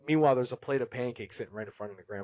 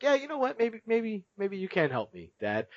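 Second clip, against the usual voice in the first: high-pitched imitation of a hesitant boy; Morty; 8 semitones higher than usual. Son reluctantly accepting dad's offer, voiced high. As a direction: pitch it up as a kid giving in. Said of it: "Morty's just like".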